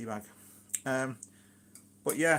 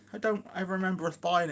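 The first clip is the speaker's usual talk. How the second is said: partner's voice, lightly put on higher; higher-pitched, light imitation of a partner; imitating other half; 6 semitones higher than usual. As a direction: quote your other half in a raised pitch. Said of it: "she goes".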